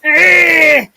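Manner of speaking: loud high growling roar of a mad animal; imitated angry roar. Mimicking the juvenile throwing the log, loud roar. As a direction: roar like a furious young bigfoot. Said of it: "he goes like".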